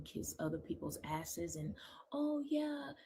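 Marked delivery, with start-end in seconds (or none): 2.1-2.9 high-pitched mock agreement; mimicking others. Speaker mocks people kissing up to others, high mimicry. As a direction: imitate an eager flatterer in a much higher voice. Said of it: "like, mimic".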